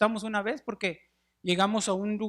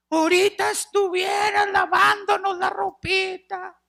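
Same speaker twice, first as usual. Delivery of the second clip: high, loud reproachful wail; grieving shout; 11 semitones higher, 4 dB louder than usual. Retold or imitated. Mourner at the wake shouts guiltily, high wailing. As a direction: cry it out over the body at the wake. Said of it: "alguien gritaba allí".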